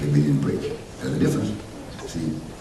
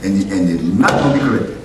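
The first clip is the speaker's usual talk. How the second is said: loud preaching; 4 semitones higher, 4 dB louder than usual. Acted.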